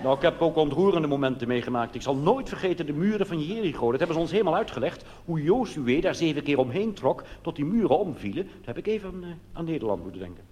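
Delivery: ordinary talk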